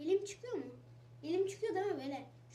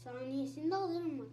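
usual speaking voice

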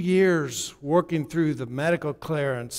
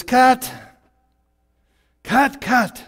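high, loud repeated calls of cut; impatient director; 7 semitones higher, 6 dB louder, much slower than usual. Acted. Director cutting the actors off for lacking feeling, loud high calls. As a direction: stop them fast, cutting again and again. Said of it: "and then he says".

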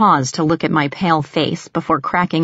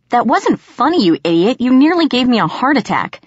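loud, high furious scolding; angry scolding; 7 semitones higher, 4 dB louder than usual. A narrator's reading. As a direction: tear into him, still shaken. Angry after being scared.

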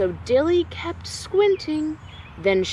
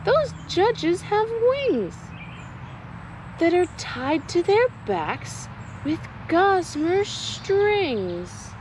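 much higher, slow wondering voice; puzzled Dilly; 7 semitones higher than usual. Acted. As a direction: notice something odd and muse on it. Dilly notices the judges.

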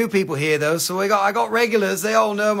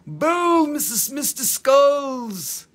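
playful greeting; 7 semitones higher, 3 dB louder, much slower than usual. Real, not acted. Greeting viewer by nickname.

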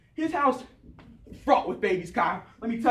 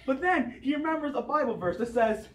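ordinary talk, nothing marked

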